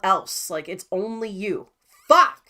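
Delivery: normal talking voice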